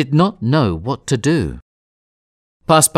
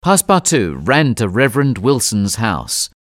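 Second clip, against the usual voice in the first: usual speaking voice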